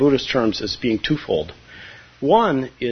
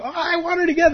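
much higher, pleading mock whine; a hammed-up student begging for more; whiny craving; faster than usual. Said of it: "you go to your teacher and you go".

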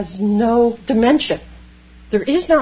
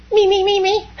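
higher, louder whiny me me me; mock ego; 10 semitones higher, 4 dB louder than usual. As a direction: poke fun at the grasping self. Mocking the self-centered ego.

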